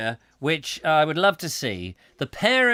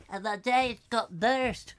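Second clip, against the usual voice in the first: odd put-on voice; 5 semitones higher, 6 dB quieter, slower than usual. Put-on voice after the laugh.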